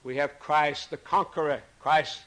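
usual speaking voice

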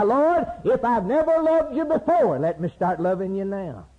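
impassioned cry to the Lord, pitched high; high preacher's plea to God. Acted. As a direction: cry out in a pleading prayer, then come down. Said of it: "Just cry".

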